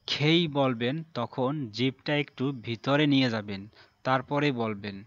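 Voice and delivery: ordinary talk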